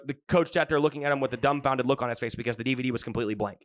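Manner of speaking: ordinary delivery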